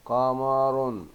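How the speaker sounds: "قمر" slowly as "qa-maa-run"; tanween damma ending. Shown as a demonstration. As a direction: stretch قمر to a voiced nun ending. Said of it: "بالضم".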